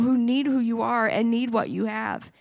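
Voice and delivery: ordinary talk, nothing marked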